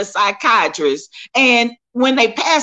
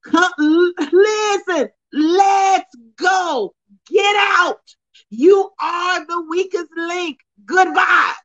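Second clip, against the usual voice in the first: high, shouted casting out; hammed-up preacher yell; 5 semitones higher, slower than usual. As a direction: drive him out with a shout. Shouted banishing, preacher style.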